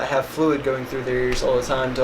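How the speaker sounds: normal talking voice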